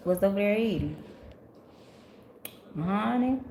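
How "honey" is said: ordinary talk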